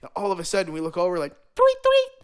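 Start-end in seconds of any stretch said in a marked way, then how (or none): 1.5-2.1 bird tweet imitation. Imitating birds overhead.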